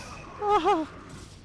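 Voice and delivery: shrieking laugh. Real, not acted. After storm scream.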